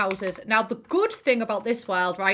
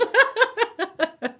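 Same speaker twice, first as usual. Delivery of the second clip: loud, high burst of laughter; real laughter; 12 semitones higher, 8 dB louder than usual. Real, not acted. Vlogger amused by her Sims metaphor, loud high laugh. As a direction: crack up at your own joke. Cracks up at own joke.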